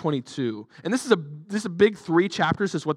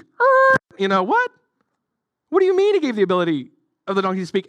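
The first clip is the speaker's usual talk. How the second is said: mock disbelief; 3 semitones higher, 5 dB louder, faster than usual. Real, not acted. Preacher to congregation, faking shock at a talking donkey. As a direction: act baffled, fast and a bit louder.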